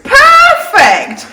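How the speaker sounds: excited. Acted. King delighted.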